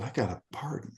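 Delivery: confessing quietly to cellmates; whispering. Retold or imitated. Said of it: "so I was whispering".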